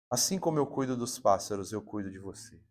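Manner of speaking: low, soft and tender, barely above a hush; gentle voice of God. Retold or imitated. Preacher voicing God's comfort, low and soft. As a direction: speak as a caring God reassuring his child. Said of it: "E aí Deus falou comigo".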